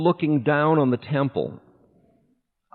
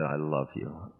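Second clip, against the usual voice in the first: retold sob; 8 semitones lower, 12 dB quieter than usual. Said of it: "I was sobbing".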